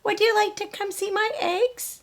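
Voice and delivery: high, bright little hen voice; Dora the hen. Acted. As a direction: play a chirpy young hen wondering aloud. Dora asks proudly.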